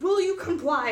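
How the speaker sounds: high-pitched mock demand; demanding robot. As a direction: play the robot pressing its question. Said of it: "he's like".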